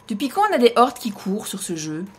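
normal talking voice

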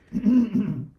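clears a froggy throat; loud throat clear. Real, not acted. Frog in her voice.

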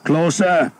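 loud, high battle shout; shouted order. A narrator's reading. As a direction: bellow it across the field. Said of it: "Skeet shouted".

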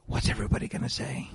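hushed anxious whisper; whispered worry. Retold or imitated. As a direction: whisper it like a fearful aside. Worried parent imagining gossip.

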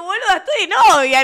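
loud, high protest; telling the girl she has a girlfriend, loud and high; emphatic retort. Retold or imitated. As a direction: push her off firmly. Retold emphatic refusal.

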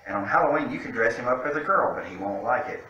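ordinary delivery

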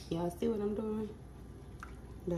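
normal talking voice

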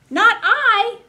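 high, loud piggy squeal. Acted. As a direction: squeal it like a little pig. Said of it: "squealed the pig".

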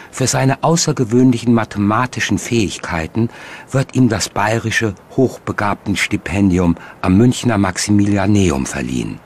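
ordinary talk

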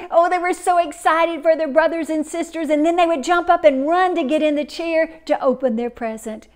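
normal talking voice

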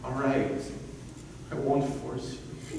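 soft, low concession; resigned giving in. Acted. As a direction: give in quietly, defeated. Father gives in to daughter.

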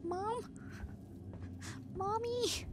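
put-on high, small frightened child voice; scared child. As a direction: call out like a lost little kid.

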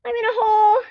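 high, loud whiny plea; whiny distress. Real, not acted. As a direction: whimper it stuck and helpless. Stuck in hole, whining.

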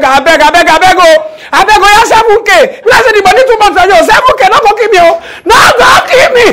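frantic begging. Retold or imitated. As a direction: yell your pleas like the car might crash. Begging the reckless Uber driver.

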